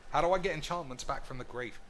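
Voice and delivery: normal talking voice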